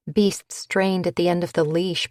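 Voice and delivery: ordinary delivery, nothing marked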